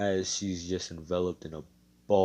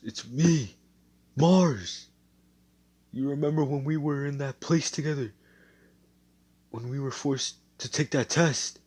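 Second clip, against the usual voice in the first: pleading to be remembered; 6 semitones higher, 4 dB louder than usual. Acted. Mars begs Fauna to remember.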